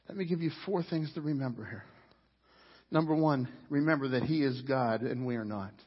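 ordinary talk

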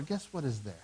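ordinary talk, nothing marked

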